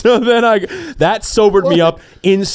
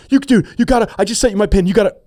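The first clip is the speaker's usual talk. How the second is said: urgent rush; 8 semitones higher, faster than usual. Retold or imitated. Urgent call before phone dies.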